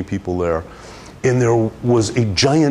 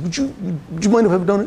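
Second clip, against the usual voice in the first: timid request; 10 semitones higher, much faster than usual. Retold or imitated. Timidly asking the angry client.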